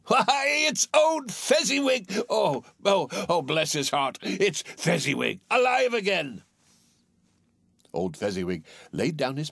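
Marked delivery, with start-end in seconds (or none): 0.1-6.4 high-pitched, overjoyed cry; great excitement. A narrator's reading. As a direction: burst out in astonished delight, drawing out the words. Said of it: "Scrooge cried in great excitement".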